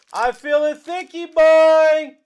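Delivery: loud, slow teasing chant for a big card; drawn-out hype. Acted. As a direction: stretch it out to hype the chat. Repeats it sing-song for hype.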